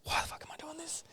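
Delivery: breathy whispered self-question, mock frustration; inner-thought whisper. Retelling an angry moment at an email, whispered to himself. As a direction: whisper it like a thought you'd never say aloud. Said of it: "And I just thought".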